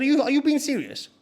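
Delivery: high-pitched retold disbelief; incredulous. Guest challenging the host on the phone, incredulous. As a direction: question it high, hardly believing it.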